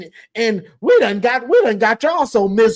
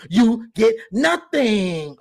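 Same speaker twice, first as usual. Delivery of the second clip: slow taunt; much slower than usual. Pretend. Taunting Ohio State.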